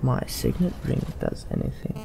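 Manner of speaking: usual speaking voice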